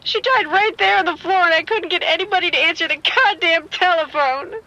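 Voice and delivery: high, frantic panic over a dead body; breathless fear, words spilling out; panicked. Acted. Caller to the police sergeant, panicking.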